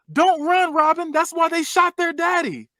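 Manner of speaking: high, drawn-out mimicked holler; slow, high-pitched mock yell; retold yell. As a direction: yell it out high, imitating her warning. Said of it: "How you just yell something out like that".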